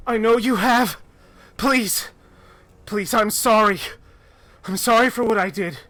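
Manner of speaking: high, loud, desperate pleading; frantic apology. Acted. Abadara apologises to the Manticore, high desperate pleading. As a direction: beg forgiveness, voice climbing and breaking. Woman begging the monster.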